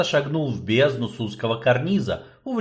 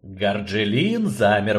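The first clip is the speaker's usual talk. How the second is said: usual speaking voice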